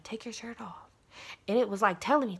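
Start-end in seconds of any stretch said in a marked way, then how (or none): 0.0-0.9 whispered inner temptation; voice in the head. Retold or imitated. Mind urges her to undress, whispered. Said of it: "I remember my mind saying".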